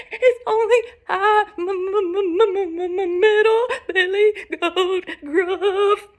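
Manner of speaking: high, trembling stammer; frightened goat. Acted. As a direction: stutter in a shaky, high-pitched panic. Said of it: "shook so hard he almost fell down".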